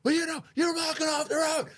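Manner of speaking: high-pitched mock shriek; mad woman yelling. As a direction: imitate a furious driver screeching. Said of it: "and then she's like".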